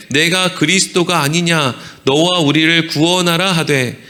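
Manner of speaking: even, measured reading of scripture. A narrator's reading. Preacher reads Luke's account to the congregation, even reading. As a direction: read the passage aloud without acting the quotes.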